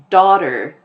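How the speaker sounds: daughter with an American r at the end; American accent. Shown as a demonstration. Teacher showing how Americans say daughter, American accent. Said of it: "the way that I'm saying it".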